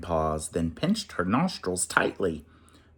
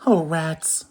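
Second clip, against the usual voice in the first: weeping; 7 semitones higher, 4 dB louder than usual. Acted. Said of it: "Mouse wept".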